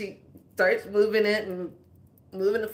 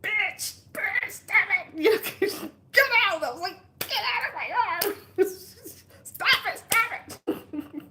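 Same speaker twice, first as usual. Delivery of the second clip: high laughing scolding of the arm; mock scolding; 11 semitones higher than usual. Yelling at her own sore arm, high mock scolding. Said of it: "And I'm like".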